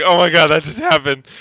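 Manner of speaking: loud retold astonishment; stunned retold exclamation; freaked out. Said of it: "I, like, totally freaked out".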